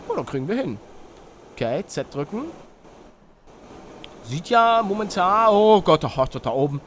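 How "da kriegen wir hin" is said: usual speaking voice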